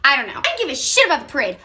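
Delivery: ordinary talk, nothing marked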